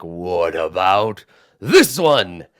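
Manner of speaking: very slow cyclops question; ponderous giant curiosity; curious giant. Acted. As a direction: eye the little one like a snack.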